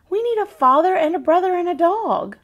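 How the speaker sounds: put-on a little girl telling Nana what the family lacks; child's insistent wish. A narrator's reading. Grace tells Nana her wish.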